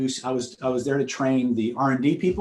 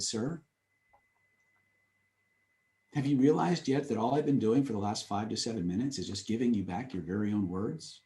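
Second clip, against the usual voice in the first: delicate quiet; 4 semitones lower, 7 dB quieter than usual. Retold or imitated. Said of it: "I looked at him and I said".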